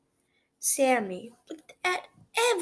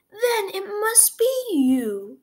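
teacher voice; 10 semitones higher, 7 dB louder than usual. A narrator's reading. Teacher finds the seal.

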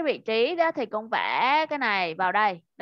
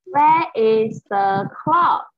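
slow, loud, careful question with a rise on "clock"; slow teacher read; 7 dB louder, much slower than usual. Shown as a demonstration. Teacher models the question slowly for her pupil. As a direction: stretch each word of "Where is the clock" for a child. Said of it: "teacher reads the question".

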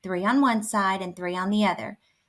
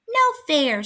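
high squeak of protest; squeaky mouse; 7 semitones higher, 4 dB louder than usual. A narrator's reading. Mouse protesting after being yanked over, squeaky. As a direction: squeak it indignantly like a small mouse. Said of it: "squeaked Mouse".

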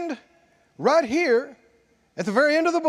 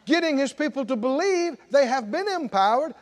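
usual speaking voice

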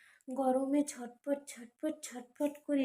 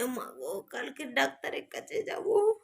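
normal talking voice

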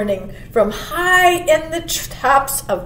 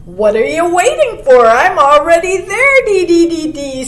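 raised, loud, chirpy bird voice; teasing chickadee; 6 semitones higher, 7 dB louder than usual. A narrator's reading. Chickadee already at the stranger, loud and chirpy. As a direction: call out, ending in dee-dee chirps. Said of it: "said the chickadee".

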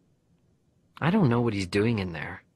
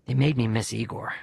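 plain reading voice

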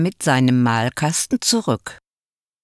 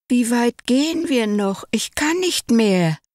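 hoch und weinerlich klagend; quengelnd, völlig erschöpft; jammernd; 8 semitones higher than usual. A narrator's reading. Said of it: "jammerte der Kurze". (hoch und jammernd, müde vom Laufen)

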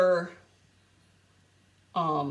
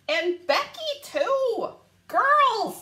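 ordinary delivery

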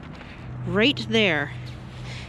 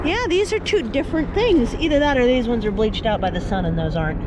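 raised, excited voice over noise; yelling over helicopter; 7 semitones higher than usual. Real, not acted. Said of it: "Sorry about the helicopter".

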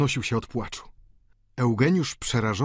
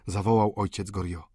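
ordinary delivery

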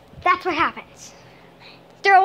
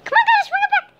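high, loud coaxing call to the cat; sing-song call to bring the cat over; squeaky "come on" to a pet; 3 dB louder, faster than usual. Real, not acted. Calling cat, laughing.

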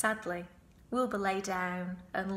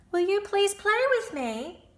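high, loud begging piglet voice; pleading piglet; 11 semitones higher, 6 dB louder than usual. Acted. Wilbur begging the lamb to play. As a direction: ask sweetly like a hopeful little pig.

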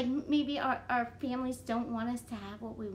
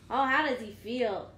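plain reading voice

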